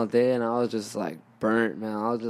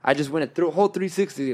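ordinary talk

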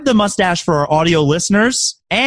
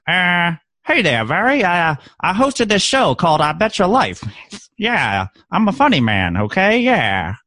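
lightly voiced wisecracking comedian; a hint of the old-time quipster; Groucho Marx impression; 2 semitones higher than usual. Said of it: "impersonation of a famous person with a mustache".

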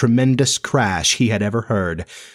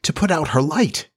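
higher, pleading request; begging; 6 semitones higher than usual. A narrator's reading. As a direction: plead as a storyteller voicing a girl. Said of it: "Wendy begged".

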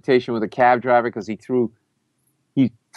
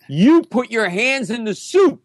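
high, drawn-out mock yell; imitated outraged yell; yelled accusation; 10 semitones higher, slower than usual. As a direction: accuse publicly, slowly and high. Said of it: "And he yelled at me".

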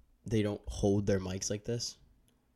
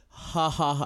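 loud mock laugh right into the mic, pitched up; 7 semitones higher, 6 dB louder, slower than usual. Imitating a guest who laughs into the mic, hammed-up loud. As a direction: ham up a fake cackle into the mic. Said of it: "you're not gonna go".

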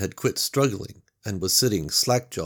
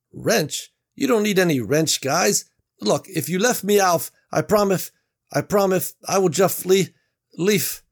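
higher, louder begging, words slipping; pleading, slurring boss; 10 semitones higher, 6 dB louder than usual. Acted. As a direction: plead as speech starts to fail.

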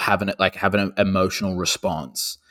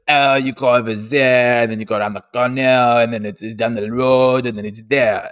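imitated French accent; 4 semitones higher, 4 dB louder than usual. Comedian giving directions in Paris, mock French accent. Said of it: "speaking in the accent of the foreign country".

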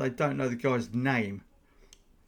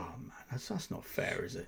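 whispered aside; 13 dB quieter than usual. Real, not acted. Sympathetic aside.

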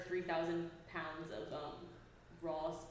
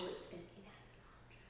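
much softer, fast whisper to herself; whispered musing; 16 dB quieter, faster than usual. Real, not acted. Co-host whispering the clue over to herself. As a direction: whisper the clue back to yourself.

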